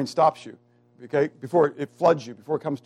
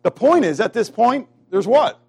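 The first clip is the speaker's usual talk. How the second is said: loud, high, drawn-out pulpit declaration; full-force preaching; 7 semitones higher, 6 dB louder, slower than usual. Real, not acted. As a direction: thunder each phrase as final truth.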